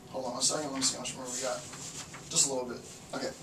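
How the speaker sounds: low, soft aside to yourself; quiet, low thinking aloud; muttered aside. Real, not acted. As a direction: drop your voice while checking something. Checking how much material is left.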